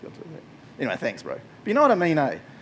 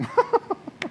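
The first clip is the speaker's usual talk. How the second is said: evil cackle. Retold or imitated. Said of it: "imagine Satan just being like".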